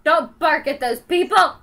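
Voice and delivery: loud scolding shout; yelling at the dog. Real, not acted. Owner scolding dog for barking at people, loud.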